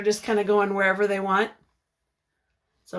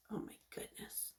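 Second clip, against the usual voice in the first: whispered wonder; 25 dB quieter than usual. Real, not acted. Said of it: "teacher reacting to big family".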